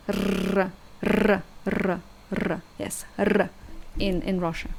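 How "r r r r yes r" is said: R said as a rolled Russian R; rolled Russian R demo. Shown as a demonstration. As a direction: trill the tongue on R. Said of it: "we have this sound R".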